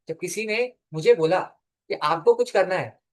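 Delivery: normal talking voice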